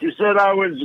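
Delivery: high, shouted heckle from the back; mock yell. Cosby heckling the announcer from the back, high mock yell. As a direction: yell it up at the stage in mock protest. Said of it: "he's yelling this in the audience".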